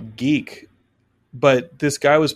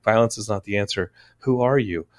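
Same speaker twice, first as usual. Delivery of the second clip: softer, lower scolding voice; quietly disappointed wife, lightly voiced; wife scolding her husband after the movie, mildly mimicked; mimicking wife's scolding; 4 semitones lower, 5 dB quieter than usual. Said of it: "be like".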